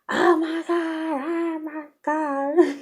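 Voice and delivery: high, slow, stunned oh my God; shocked. Retold or imitated. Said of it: "I was just devastated and shocked".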